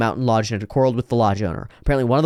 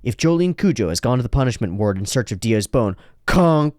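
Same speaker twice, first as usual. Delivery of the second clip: ordinary talk, nothing marked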